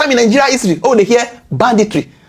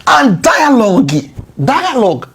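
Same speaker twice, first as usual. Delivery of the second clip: ordinary delivery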